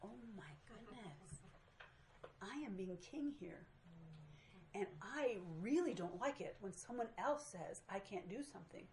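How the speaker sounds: woman quietly realizing her stubbornness, hushed; quiet inner realization. Retold or imitated. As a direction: think it softly to yourself. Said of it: "it dawned on me".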